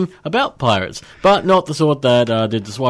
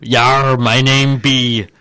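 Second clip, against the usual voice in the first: pirate voice; 4 dB louder, much slower than usual. Pretend. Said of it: "Speak Like a Pirate Day".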